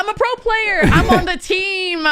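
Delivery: high, drawn-out silly bragging; hammed-up high-pitched show-off; mock pro-player boast. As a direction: brag in a squeaky, stretched-out put-on voice.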